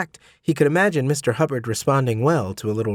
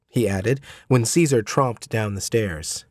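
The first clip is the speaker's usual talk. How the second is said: plain reading voice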